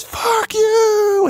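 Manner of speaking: a very high, loud screamed curse; screaming. Retold or imitated. Teen yelling back at the band, high screamed curse. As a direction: scream it at the stage with fingers up. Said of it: "And I just start screaming it".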